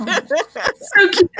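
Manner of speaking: high, laughing so cute; laughing coo. Real, not acted. Laughing along with friends about the couple, high giggly coo. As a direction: squeal it through a laugh.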